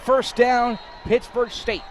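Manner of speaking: high, shouted first-down call; excited call. Real, not acted. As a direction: announce it with a shout. Announcer calls first down.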